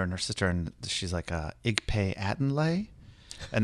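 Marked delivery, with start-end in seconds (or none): 1.7-2.9 Pig Latin said slowly, questioning; retold Pig Latin, higher and slow. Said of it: "they started speaking Pig Latin".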